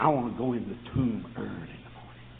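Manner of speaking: hushed unvoiced request; whisper. Asking the tomb keeper privately, whispered. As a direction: whisper it secretly.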